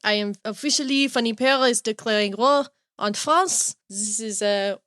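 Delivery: mock-official French accent. Host declaring war on France, mock French accent. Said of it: "I started with this French accent".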